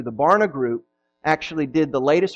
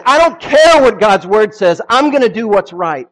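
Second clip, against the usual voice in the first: loud, defiant, fed-up shout; frustrated outburst; 4 semitones higher, 10 dB louder than usual. Retold or imitated. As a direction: blurt it out in frustration. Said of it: "they said".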